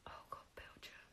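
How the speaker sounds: breathless whisper; whispered dread. Real, not acted. Recalling the Belgium trip, in a whisper. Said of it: "dreading the Belgium memory".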